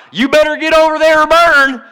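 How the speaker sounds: preacher's warning about burning, hammed up and loud; mock hellfire preacher. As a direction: caricature a street preacher yelling threats.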